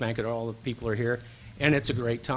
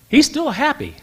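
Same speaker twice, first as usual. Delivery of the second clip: incredulous; 13 semitones higher, 7 dB louder than usual. Real, not acted. Disbelief at the coach's cheer.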